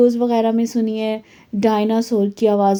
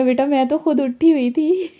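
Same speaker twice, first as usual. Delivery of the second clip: higher, laughing through the words; amused, giggling as she answers; laughing reply; 6 semitones higher than usual. Retold or imitated. Mother telling her daughter she was awake too, laughing.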